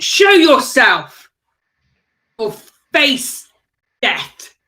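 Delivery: slow, forceful challenge, each word set apart; commanding shout. Acted. Player cracking a whip at an invisible foe, commanding shout. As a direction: call out a threat to a hidden foe. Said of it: "whip it on the ground and shout".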